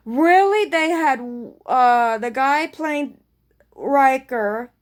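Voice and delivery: can't believe they did it; incredulous really. Real, not acted. Disbelief at cameo casting.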